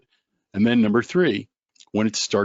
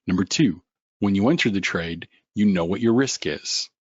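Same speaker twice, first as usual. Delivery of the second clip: normal talking voice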